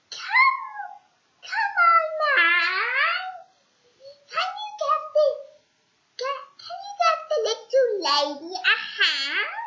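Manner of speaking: put-on very high, loud old lady. Old lady asking Mister Appleseed for a hand. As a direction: plead in a high old woman's voice.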